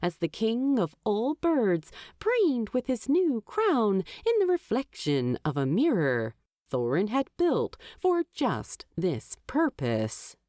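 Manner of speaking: ordinary delivery, nothing marked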